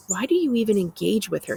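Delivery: usual speaking voice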